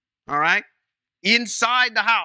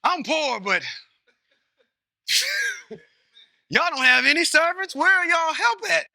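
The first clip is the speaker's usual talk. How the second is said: playful Gideon impression; 8 semitones higher than usual. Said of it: "he'd be like".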